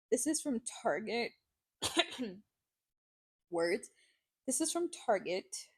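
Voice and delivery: choked, strained voice after water; coughing up water. Real, not acted. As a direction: talk through a coughing fit. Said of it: "water went down the wrong pipe".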